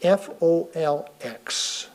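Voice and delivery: letters F O L X said one by one, slowly; spelled out. Shown as a demonstration. Host spelling out folks letter by letter, slow and clear. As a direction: spell F O L X out carefully. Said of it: "She is spelling folks".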